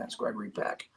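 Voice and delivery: hushed, breathless awe; starstruck whisper. Retold or imitated. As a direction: whisper it in disbelief. Inner thought, starstruck.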